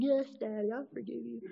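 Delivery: a hint of a sweet little girl; little daughter. Daughter forgiving her daddy about the pink cup, small and high. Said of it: "She said".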